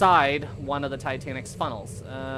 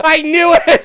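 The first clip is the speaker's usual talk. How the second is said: shouted disbelief; 13 semitones higher, 14 dB louder than usual. Real, not acted. Caught rickrolled by friend Sam.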